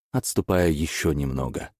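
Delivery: ordinary delivery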